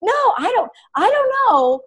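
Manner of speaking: high, squeaky hemming and hawing; reluctant excuses. Retold or imitated. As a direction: wriggle out of it sheepishly. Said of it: "you're like".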